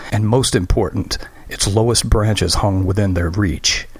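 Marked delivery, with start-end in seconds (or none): none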